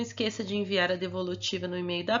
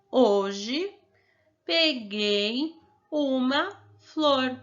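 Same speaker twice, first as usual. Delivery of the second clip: put-on each word stretched out slowly for a child; slow reading aloud; 3 semitones higher, 3 dB louder, much slower than usual. Shown as a demonstration. Teacher reading the sentence slowly to the pupil. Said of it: "o que que está dizendo nesta frase".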